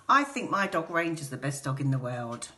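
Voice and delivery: ordinary delivery, nothing marked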